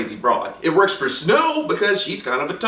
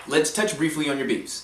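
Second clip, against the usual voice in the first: ordinary talk, nothing marked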